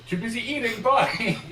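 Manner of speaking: high, loud, laughing getaway; hurried excuse. Acted. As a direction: blurt a high-pitched goodbye. Diesel excuses himself, mouth full.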